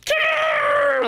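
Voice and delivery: high hawk screech; hawk cry. Retold or imitated. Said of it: "you hear that hawk, like".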